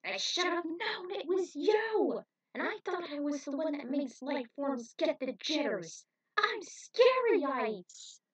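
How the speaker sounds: high, slow, menacing alien brag; boastful alien villain. A narrator's reading. Alien brags to the heroes, menacing and slow. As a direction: gloat like a showy space monster.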